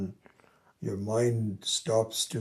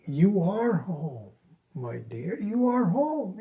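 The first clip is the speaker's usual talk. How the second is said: father soothing; 8 semitones higher, slower than usual. Retold or imitated. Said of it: "So his father kept saying".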